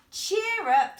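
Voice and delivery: loud, cheery call; loud cheer-up. A narrator's reading. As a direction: call out brightly. George comforts dog.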